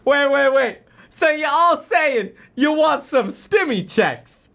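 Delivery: a lightly hammed-up skeptical interviewer; mock Wall Street Journal. Streamer mocks the journal's interviewer questioning the CFO, lightly hammed-up. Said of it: "had the Wall Street Journal going".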